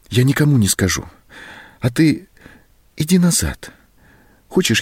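выше голосом, сбивчиво, с паузами; уговаривай робко, подбирая слова; просительные уговоры. A narrator's reading. Зоська уговаривает уходящего Антона. (просительно, высоким голосом)

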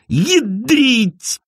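high, startled yelp of a curse; acted pained outcry; cry of pain. A narrator's reading. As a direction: curse out in sudden pain and fright. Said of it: "крича от страха и боли".

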